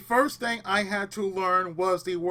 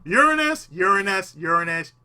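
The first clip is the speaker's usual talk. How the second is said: louder Uranus drill; 7 dB louder than usual. Shown as a demonstration. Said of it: "Just say Uranus".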